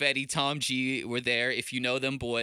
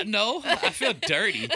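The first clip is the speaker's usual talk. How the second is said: laughing; 12 semitones higher, 8 dB louder than usual. Real, not acted. Jokes, bursting out laughing.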